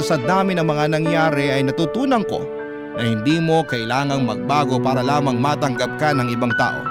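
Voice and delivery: ordinary talk, nothing marked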